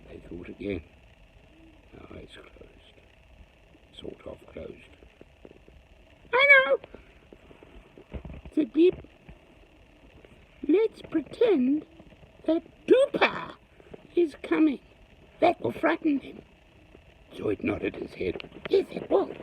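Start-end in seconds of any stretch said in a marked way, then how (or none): 1.9-4.9 hushed whisper. Acted. Hushed aside in story. 6.3-19.3 high squeaky little creature, scheming; small creature voice. Acted. Beep and Zoid voiced.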